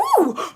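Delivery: loud, high gasp; surprised gasp. Real, not acted. Sees new member notice.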